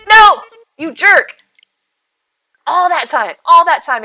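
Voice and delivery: loud, high cry of frustration; exasperated outburst. Real, not acted. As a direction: shout it in real annoyance at the game. Upset at a failed snowboy in game.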